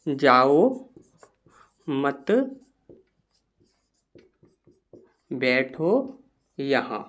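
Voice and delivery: low, soft dictation with long gaps; quiet, measured note-taking voice; slow dictation. Shown as a demonstration. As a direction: dictate word by word, leaving long pauses.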